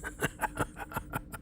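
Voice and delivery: quiet breathy villain laugh; soft sinister chuckle. Acted. Villain amused by his own threat, quiet chuckle. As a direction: chuckle darkly under your breath.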